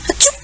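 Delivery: quick acted sneeze. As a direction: sneeze out the word. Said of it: "sniffed and sneezed".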